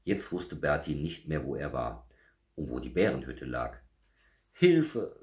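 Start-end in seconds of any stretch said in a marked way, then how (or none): 4.5-5.2 high, loud call for help; cry for help. A narrator's reading. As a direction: call out as a frightened little bear. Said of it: "rief der kleine Bär".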